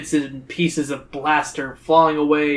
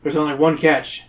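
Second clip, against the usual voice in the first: usual speaking voice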